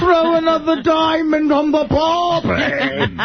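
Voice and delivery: slow, high mock Australian accent. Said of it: "That's Australian".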